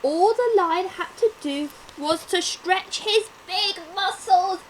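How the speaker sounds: usual speaking voice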